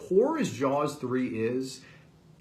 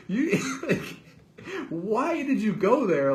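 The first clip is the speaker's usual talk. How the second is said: laughing disbelief; 10 semitones higher, 4 dB louder than usual. Real, not acted. Exasperated at the plot.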